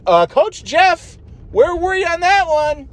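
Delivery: mock host voice. Imitating Mindy scolding Coach Jeff, high sweet mock voice. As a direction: ask it like a fake-sweet host. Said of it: "so she's like".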